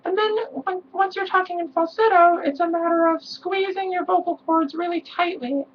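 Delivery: very high male falsetto. Shown as a demonstration. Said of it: "this is falsetto".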